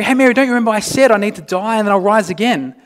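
a hammed-up know-it-all reminder; mock lecturing. Preacher mocks a Jesus lecturing Mary, fast and pushy. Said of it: "He doesn't even say to Mary".

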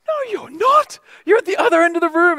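high, loud incredulous retort; mock inner retort. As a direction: scoff at it in your head. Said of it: "I'm thinking to myself".

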